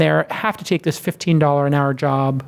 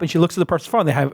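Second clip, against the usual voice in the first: ordinary talk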